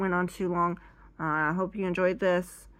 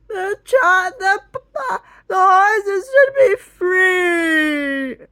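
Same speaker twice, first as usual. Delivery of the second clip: very high, loud, slow crying wail; hammed-up blubbering plea; sobbing wail; 13 semitones higher, 12 dB louder, much slower than usual. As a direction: drag it out through mock sobs. Past self sobbing about horses.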